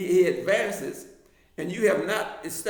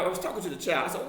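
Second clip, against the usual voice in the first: normal talking voice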